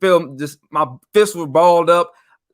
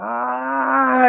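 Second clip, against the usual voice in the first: high, ringing cry that seems to travel; reverberating voice; 11 semitones higher than usual. Retold or imitated. His dream voice bursting out, high and echoing. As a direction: let it carry like it echoes across the world. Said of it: "reverberating through the whole world".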